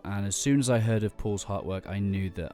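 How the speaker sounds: polished podcast intro read over music; announcer read. Acted. Host introducing his podcast episode, announcer read. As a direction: sell the show like a radio host.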